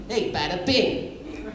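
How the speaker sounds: lightly done Brooklyn wise guy; Brooklyn accent. Acted. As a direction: bada bing Brooklyn drawl. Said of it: "Brooklyn accent".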